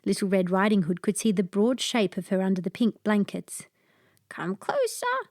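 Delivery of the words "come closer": much higher, softer husky fake grandma; a disguised dog luring the girl close. A narrator's reading. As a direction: coax her in, husky and hushed. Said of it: "said the husky voice".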